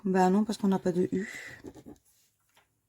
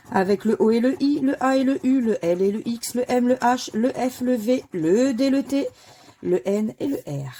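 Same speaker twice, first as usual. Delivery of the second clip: raised, loud call of each letter; higher, louder bingo-caller style; letter draw announcement; 4 semitones higher, 8 dB louder than usual. Acted. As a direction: announce every letter like a game-show draw. Calls out the drawn letters.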